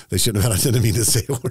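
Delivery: laughing apology for cursing; sheepish laughing retraction after a slip; embarrassed laugh. Real, not acted. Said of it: "Yeah, you're fine".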